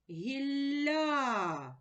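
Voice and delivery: наголос на другий склад «Гілля», протяжно; виділяє наголошений склад у «Гілля»; stress demo. Shown as a demonstration. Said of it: "куди падає наголос". (наголос у слові «Гілля»)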